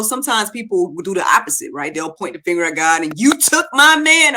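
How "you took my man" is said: higher, louder, slow accusation; a pained cry of blame, pitched up and drawn out; mock accusing God. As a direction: hurl the blame at God like a grieving widow. Person blaming God in grief.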